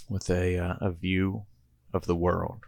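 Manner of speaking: usual speaking voice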